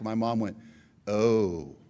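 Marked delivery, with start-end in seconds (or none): 1.1-1.8 imitated mom's loaded, knowing "oh"; ominous oh. Mom hearing about the unreturned calls, ominous. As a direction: let the oh drop, full of meaning. Mom reacts to bad news.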